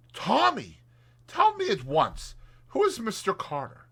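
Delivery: put-on a breathless girl demanding to know; eager young woman. A narrator's reading. Tuppence voiced in audiobook.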